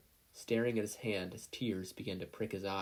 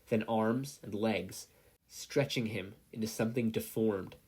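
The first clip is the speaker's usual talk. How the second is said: usual speaking voice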